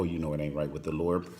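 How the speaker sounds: usual speaking voice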